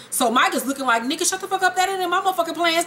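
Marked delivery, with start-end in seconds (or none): 1.0-2.9 higher, quicker irritated snap; annoyed boss. Acted. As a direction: snap it off fast and pitched up, fed up. Mike's inner reaction, annoyed.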